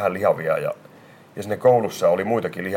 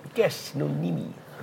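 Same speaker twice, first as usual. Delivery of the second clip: higher, slow, stern schoolteacher voice; stern teacher; 6 semitones higher, 7 dB quieter, slower than usual. Retold or imitated. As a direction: summon the cheeky pupil slowly and coolly. Teacher summons the boy. (tiukka opettaja kutsuu oppilaan luokseen, hitaasti)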